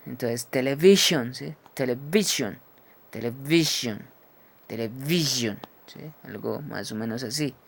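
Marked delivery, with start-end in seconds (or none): 0.5-1.3 "television" with a buzzed ZH in the middle; loud model of "television", SH blended with Z; voiced ZH demo. Shown as a demonstration. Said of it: "combinándolo con, con una Z". 1.7-5.6 "television" repeated, leaning on the buzzed middle sound; voiced ZH demo. Shown as a demonstration. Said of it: "combinándolo con, con una Z".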